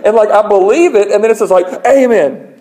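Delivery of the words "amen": mock yelling. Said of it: "He starts yelling".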